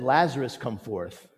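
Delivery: usual speaking voice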